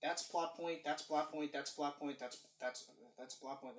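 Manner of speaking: low, soft, repetitive mumble, fading; muttered rattling-off. Retold or imitated. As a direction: rattle it off under your breath, trailing away.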